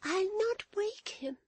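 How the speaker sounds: put-on very high, slow, breath-filled little girl; tearful child. A narrator's reading. Florence begs to lie by her brother, tearful child. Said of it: "in a voice broken by sobs and tears".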